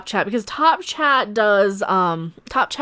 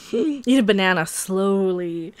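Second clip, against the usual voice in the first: ordinary delivery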